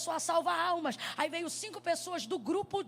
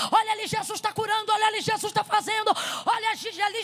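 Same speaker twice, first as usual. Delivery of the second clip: louder, higher pulpit cry; revival shout; 5 semitones higher, 8 dB louder than usual. Retold or imitated. Preacher recalling childhood sermon, loud revival shout. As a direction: proclaim it at full force. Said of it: "quando eu dizia".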